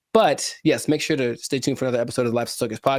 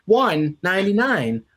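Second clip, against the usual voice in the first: usual speaking voice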